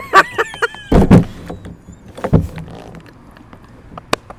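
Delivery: high laughing wail; reenacting the crying, breaking into laughter. Mock wail dissolving into laughter.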